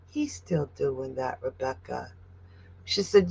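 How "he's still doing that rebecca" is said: tender. Real, not acted. Comforting a grieving viewer.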